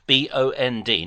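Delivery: "B O N D" letter by letter; each letter of "B O N D" said separately; spelling out. Shown as a demonstration. Spelling the word for the confused caller, spelling out. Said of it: "not a bomb".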